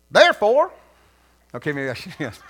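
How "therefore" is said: put-on Southern accent. Preacher starting a Southern modern-day Bible reading, Southern accent. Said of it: "the Southern modern day version".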